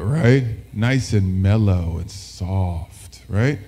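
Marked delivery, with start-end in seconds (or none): 0.7-3.2 very slow, low late-night radio voice; mellow nighttime DJ. Shown as a demonstration. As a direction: draw it out deep and mellow. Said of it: "That's our nighttime D J voice".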